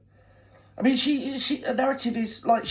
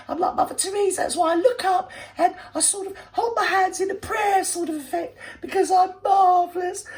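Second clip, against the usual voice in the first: much higher, hammed-up simpering voice with fake sobs; mock saintly do-gooder; 11 semitones higher than usual. Commentator mocking the speaker as a pious martyr, high and fake-teary. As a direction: mimic a self-adoring saint, weepy and high. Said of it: "co-opt it as yourself and see it through the lens of philanthropy".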